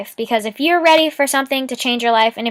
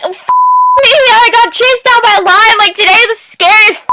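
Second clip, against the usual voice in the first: much higher, louder, breezy carefree voice; mock cheerful; 12 semitones higher, 8 dB louder than usual. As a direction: brush off a lion chase like nothing. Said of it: "just be like".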